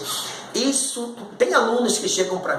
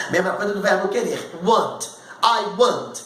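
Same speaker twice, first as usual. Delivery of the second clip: ordinary talk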